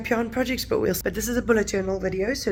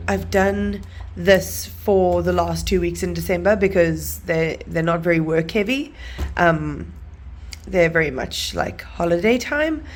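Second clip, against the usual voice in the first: normal talking voice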